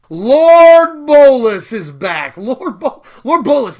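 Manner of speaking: loud, high, drawn-out cheer of joy; excited shout. Real, not acted. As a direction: proclaim it like a fan whose hero just returned. Card reveal hype, favorite character.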